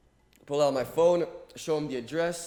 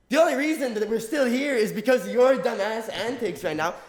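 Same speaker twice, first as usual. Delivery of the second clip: loud, high mock rant; angry scold; 8 semitones higher, 8 dB louder than usual. Speaker scolding the troublemaker, loud and angry. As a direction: tear into him for his antics.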